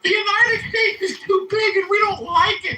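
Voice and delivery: silly high falsetto grievance; mock complaint. Heckler voicing Canadian grievance about America, mocking falsetto. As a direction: whine it like a put-on crybaby.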